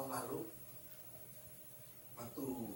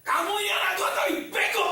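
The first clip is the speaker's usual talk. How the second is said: shouted, much higher and louder rebuke of the teacher; angry scolding; 14 dB louder than usual. Retold or imitated. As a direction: let fly at her, furious. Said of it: "Saya langsung marah sama gurunya".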